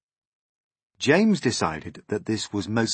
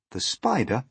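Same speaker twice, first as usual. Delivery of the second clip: plain reading voice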